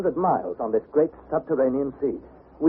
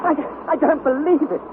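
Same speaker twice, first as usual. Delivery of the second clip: stammering disbelief; 12 semitones higher than usual. Acted.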